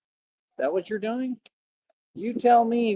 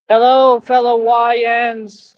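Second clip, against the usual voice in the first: big hammed-up hello; mock greeting; 2 semitones lower, 6 dB louder than usual.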